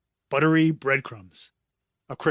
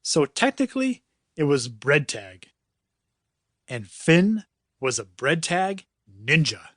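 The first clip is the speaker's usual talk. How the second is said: ordinary delivery, nothing marked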